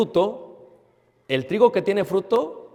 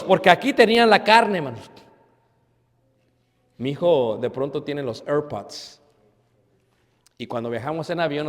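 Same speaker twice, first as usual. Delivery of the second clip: ordinary delivery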